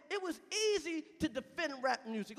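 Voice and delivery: ordinary talk, nothing marked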